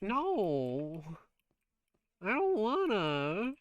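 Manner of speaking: put-on high, drawn-out childish whine; mock-pouting, stretch the vowels way out; whiny refusal. Streamer refusing chat's request to do a voice, whiny.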